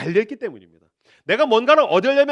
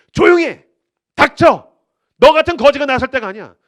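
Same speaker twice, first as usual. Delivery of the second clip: furious high shout, much louder; angry shout; 6 semitones higher, 11 dB louder than usual. Acted. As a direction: bark him into silence. Crowd shouting down the beggar.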